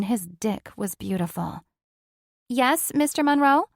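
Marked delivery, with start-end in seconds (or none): 2.5-3.7 high, loud, bright service voice; chirpy attendant answering the boss; flight attendant. A narrator's reading. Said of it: "the flight attendant".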